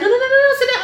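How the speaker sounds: high loud rapid nos; mock alarmed no. Nurse stopping the patient getting up, high loud alarmed nos. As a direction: stop her fast, high and loud.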